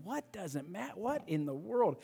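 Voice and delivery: high, hushed disbelief; baffled. Retold or imitated. Young man puzzled by the driver's remark, hushed high bafflement. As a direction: act out a bewildered private thought, soft and high. Acted baffled inner thought.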